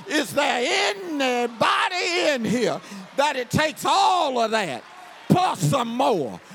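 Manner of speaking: fervent pulpit shout; full-force preaching. Acted.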